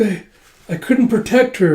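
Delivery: high, halting, distraught confession; acted crying. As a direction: wail it as if in tears. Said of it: "then she starts crying".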